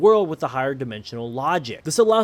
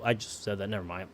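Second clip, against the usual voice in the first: quiet; 9 semitones lower, 11 dB quieter than usual. Real, not acted. Embarrassed aside.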